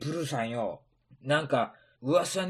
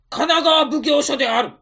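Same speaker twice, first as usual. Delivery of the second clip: loud official shout; commanding shout; 8 semitones higher, 8 dB louder than usual. Acted. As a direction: announce the raid. Magistrate raid announcement.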